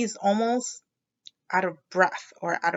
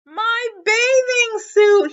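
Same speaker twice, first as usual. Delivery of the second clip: much higher, louder slow panicked shout; 13 semitones higher, 7 dB louder, slower than usual. A narrator's reading. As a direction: yell it in alarm. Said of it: "he shouted".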